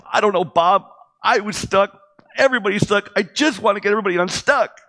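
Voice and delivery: high, loud pleading cry; loud pleading answer to his mentor; desperate outburst. Retold or imitated. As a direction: blurt it out, stuck and desperate. His desperate reply to Bob.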